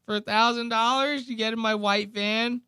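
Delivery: high-pitched predator sweet-talk; exaggerated creep impression, pitched far up; Dave, creepy. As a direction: lure them in with a high, creepy voice. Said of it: "Dave sits there and goes".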